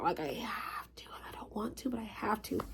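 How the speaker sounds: sudden breathy whisper aside; hushed secret aside. Real, not acted. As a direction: drop to a confiding whisper for the viewers. Aside to viewers, dropping voice.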